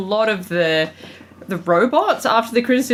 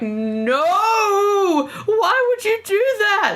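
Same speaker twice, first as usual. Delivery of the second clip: much higher wail of dismay; anguished cry; 14 semitones higher, much slower than usual. Retold or imitated. Watching the characters trash the dad's office, anguished high cry. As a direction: cry out as if watching treasures smashed. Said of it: "And I'm like".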